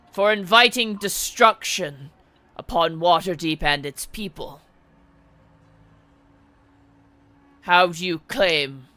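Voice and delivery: loud, slow formal accusation; a stern lord reading charges in court; stern masked lord. Acted. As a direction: pronounce judgment on the heroes.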